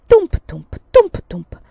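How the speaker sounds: loud rhythmic thumping; strong beat, weaker echo; bench thumps. A narrator's reading. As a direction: bang out the rocking bench in pairs. Imitated rocking bench.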